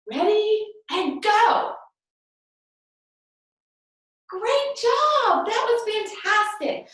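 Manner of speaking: high-pitched, enthusiastic praise; excited encouragement. Real, not acted. Teacher cheering children through a name game, excited encouragement.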